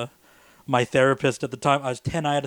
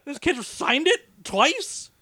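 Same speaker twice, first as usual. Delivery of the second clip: high, slow, put-on disbelief; a hammed-up school official asking in disbelief; incredulous board member; 11 semitones higher, much slower than usual. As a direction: stretch it out, high and amazed.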